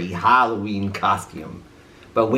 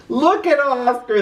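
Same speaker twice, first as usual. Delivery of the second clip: high mocking cry; jeering dogs; 11 semitones higher than usual. A narrator's reading. Dogs mocking Oscar's costume, high and jeering. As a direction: laugh at him. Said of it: "they cried".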